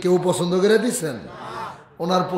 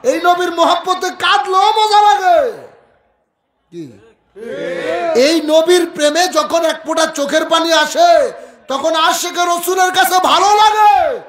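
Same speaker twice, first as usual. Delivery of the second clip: high, impassioned shouted sermon; full-force preaching; 12 semitones higher, 5 dB louder than usual. Real, not acted. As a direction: drive each phrase up into a fervent shout. (আবেগভরা উচ্চকণ্ঠে was)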